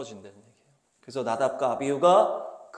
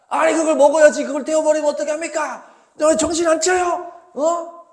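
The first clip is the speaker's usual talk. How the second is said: loud, high-pitched furious outburst; angry scolding; 12 semitones higher, 9 dB louder than usual. Acted. Moses rebuking Aaron's sons for burning the offering, angry scolding. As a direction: tear into them at full voice. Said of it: "막 화를 내는 거야".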